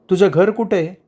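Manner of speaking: lightly voiced curious girl; casual question. A narrator's reading. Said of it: "तिने सहज विचारलं".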